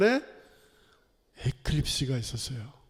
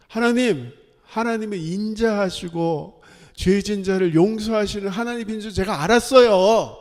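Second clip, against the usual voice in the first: angry protest to God, loud and raised; 4 semitones higher, 6 dB louder than usual. Retold or imitated. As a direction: imitate an indignant prophet, building to a loud complaint. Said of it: "막 화를 내요, 요나가". (화내며 따지듯 크게)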